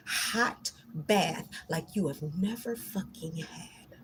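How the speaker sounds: hissed threat, dropped to a whisper; menacing whisper. Real, not acted. To the spirits fearmongering her, a menacing whisper.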